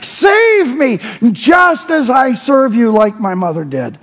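high, loud, impassioned prayer, shouted; preacher shouting a psalm of praise, voice lifted high; full-force preaching. Acted. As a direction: cry out the psalm with rising fervour.

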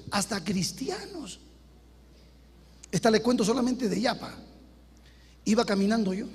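ordinary talk

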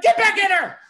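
high, loud furious order; screaming and yelling. Retold or imitated. Fuming boss chasing him into the hallway, screaming. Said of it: "Screaming and yelling at me".

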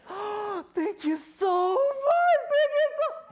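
loud falsetto gush, stretched words; overjoyed mock squeal; gushing dog lover. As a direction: squeal thanks, hammed-up and very high.

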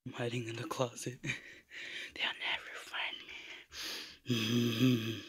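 hushed whisper from the closet; whispering while hiding. Real, not acted. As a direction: whisper so the seekers can't hear.

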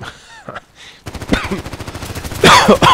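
sharp loud coughing fit. Real, not acted. Sudden cough while playing.

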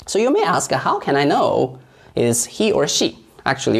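ordinary delivery

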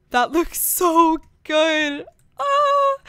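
gushing craving. Real, not acted. Gamer drooling over chips and salsa, gushing craving. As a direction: draw it out, longing for the food.

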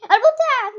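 loud, high change of mind; excited outburst. Real, not acted. Player decides to eat after all, excited outburst.

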